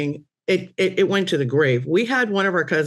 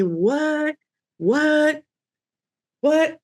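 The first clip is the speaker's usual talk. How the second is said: bewildered; 11 semitones higher, much slower than usual. Retold or imitated. Recalled baffled cry, repeated.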